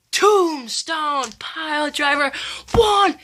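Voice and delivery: high, fast ringside calling; excited play-by-play. Pretend. Kid retelling the match, calling it like live commentary. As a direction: call the finish like a hyped commentator.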